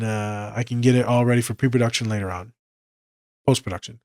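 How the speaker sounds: normal talking voice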